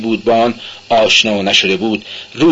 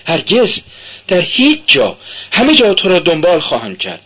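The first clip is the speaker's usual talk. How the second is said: high, raised vow; crying out; 8 semitones higher than usual. A narrator's reading. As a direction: cry it out to him, fervent. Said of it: "او فریاد زد".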